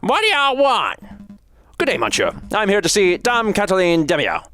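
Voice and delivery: grumpy guard hurrying them along; gruff guard. Acted. Guard escorting the lawyer, impatient and high-pitched. Prison guard leading visitors.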